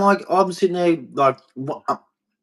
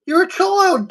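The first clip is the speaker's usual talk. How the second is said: high scornful jab. Real, not acted. Insulting gang members, heated rant.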